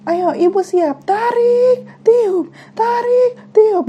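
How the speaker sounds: high, drawn-out imitation of a midwife's chant; long, high-pitched mock urging; delivery-room coaching. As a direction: coach a mother through breathing. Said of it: "kedengeran gitu loh suaranya kayak".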